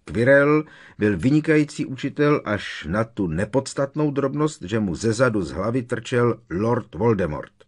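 angry outburst. A narrator's reading. Harry mocks Quirrell as an excellent teacher, shouting out. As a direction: raise the voice in heated sarcasm. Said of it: "rozkřikl se Harry".

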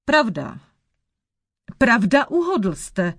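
grudging admission, mildly grumpy; mild grumpiness. A narrator's reading. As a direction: concede it with a touch of annoyance. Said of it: "mrzutě odpoví černokněžník". (mírně mrzutě)

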